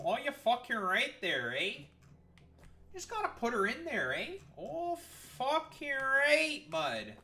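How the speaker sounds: a riled-up Canadian hockey fan; aggressive Canadian. Pretend. Streamer jokingly goes full Canadian at the factory, loud Canadian accent. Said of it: "That was aggressively Canadian".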